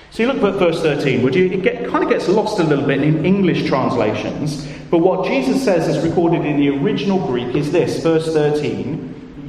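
ordinary delivery

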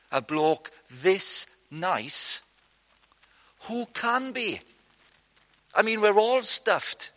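high, loud mock despair, slowed; hammed-up panic of doomed disciples. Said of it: "they didn't say that, but they might have said it like that".

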